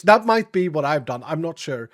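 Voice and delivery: ordinary delivery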